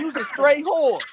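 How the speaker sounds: Baltimore lingo. Retold or imitated. Retelling the Baltimore rapper's line in Baltimore accent.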